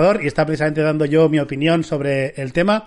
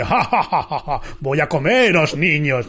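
laughing like a cartoon villain; slow mock-menacing villain cackle; evil villain laugh; slower than usual. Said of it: "como cuando Regi el otro día hizo su risa malvada".

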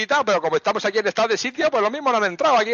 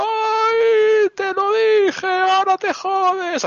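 high, slow, ghostly voice from the underworld; 10 semitones higher, 3 dB louder, much slower than usual. Pretend. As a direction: taunt her like a ghost from beyond, drawn out. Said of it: "así como el del inframundo".

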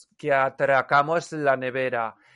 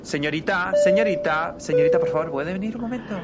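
calling out; 5 semitones higher, much faster than usual. Acted. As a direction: call the attendant across the cabin. Calling the flight attendant over.

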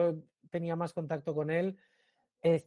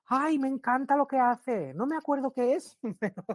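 gushing fan lady; 9 semitones higher than usual. Retold or imitated. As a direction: coo it like a starstruck fan. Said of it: "señora gushing".

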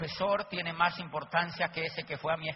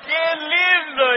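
ecstatic shout; 9 semitones higher, 14 dB louder, much slower than usual. Real, not acted. Cheering outburst.

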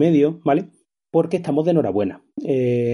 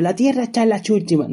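high silly clown voice; payacho voice; 8 semitones higher than usual. Pretend. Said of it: "voy a echar la opinión como payacho".

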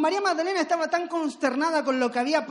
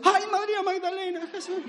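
higher-pitched mock feminine commiseration; women angels; 6 semitones higher than usual. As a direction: imitate a woman cooing in sympathy, hammed-up. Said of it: "si hubieran sido mujeres, ¿cómo hubiera sido?".

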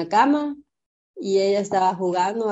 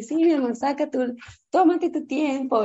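sweet patient mom; 6 semitones higher than usual. Retold or imitated.

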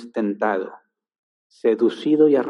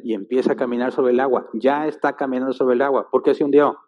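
ordinary delivery

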